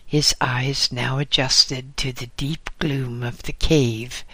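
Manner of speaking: ordinary delivery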